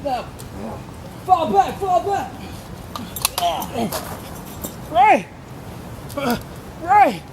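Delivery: high, urgent shouted orders; frantic retreat order. Acted. Acted combat retreat.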